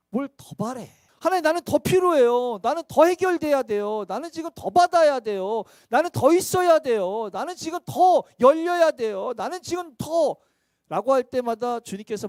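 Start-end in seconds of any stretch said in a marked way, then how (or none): 1.2-10.3 louder, higher insistent begging; pleading to God for more, louder and higher; whiny demanding prayer. Acted. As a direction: push each need harder, like a child demanding more.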